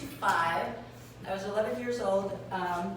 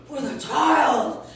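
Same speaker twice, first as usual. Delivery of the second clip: put-on slow, loud, scandalized For the child; mock shocked; 5 semitones higher, 11 dB louder, much slower than usual. Maitre d' repeating father's words, slow loud disbelief. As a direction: drag it out in disbelief.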